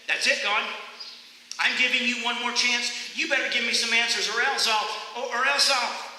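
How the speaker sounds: high, loud threatening ultimatum, faltering at the end; angry ultimatum. Retold or imitated. Furious runner giving God a last chance, loudly. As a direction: threaten God and run out of words. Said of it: "And I said".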